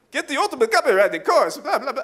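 high, loud hammy count sales pitch; Count Yannick. Acted. Count promoting a copywriting course, hammed-up pitch. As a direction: hawk it in a cartoon count voice. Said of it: "I've done promotions as, as Count Yannick".

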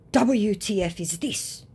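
slow, high mock indignation; pretend outrage. Woman confronting scammer with his picture, pretend outrage. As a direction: act outraged, drawing the words out. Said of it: "pretending to be outraged".

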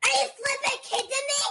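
annoyed lady. Retold or imitated. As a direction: imitate a fed-up moviegoer. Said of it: "and she's like".

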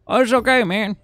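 high, coaxing it's okay; mock reassurance. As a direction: soothe yourself like a friend, hammed-up. Calming himself after failure.

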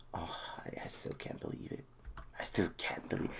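hushed disbelief; awed whisper. Real, not acted. Fan stunned by the title, an awed whisper. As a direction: breathe it in wonder. Disbelief after winning the league.

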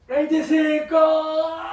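drawn out, high strained final count; straining last rep. Real, not acted. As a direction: push the last word out with effort.

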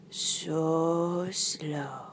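"So slow" in a drawn-out whisper; hushed slowness. Acted. Narrator showing loris's crawl, soft slow whisper. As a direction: breathe "So slow" softly, stretching it.

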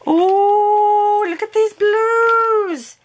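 very high, loud and slow; drawn-out high cry of delight; delighted squeal. Real, not acted. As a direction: swoon over the colour. Opens gift with blue items.

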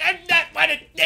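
high, loud squeaky refusal; mock refusal. Streamer to Cass about redoing missions, shrill refusal. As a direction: whine it like a defiant kid. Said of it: "I'm like".

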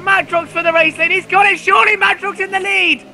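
high, thrilled race call; excited. Real, not acted. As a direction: call the lead change with excitement. Lead change call in race.

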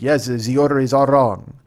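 slow, loud botched German that lands French; accidental French accent. Retold or imitated. German guy confirming the wrong order, in an accent gone French. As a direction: attempt German and slip into French. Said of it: "that's a French guy".

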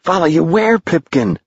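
high, anxious question; urgent calling. A narrator's reading. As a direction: call after him with pitch lifted. Tom calling after fading voice.

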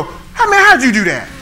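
high, loud amazed disbelief; amazed onlooker. Retold or imitated. Amazed crowd at a magic trick, high loud disbelief. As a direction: marvel at a magic trick, voice leaping up. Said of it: "magic trick and they go".

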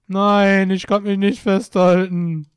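loud, slow despair at the game; panicked yell. Real, not acted. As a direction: wail that you can't hold on. Avatar slipping, panicked.

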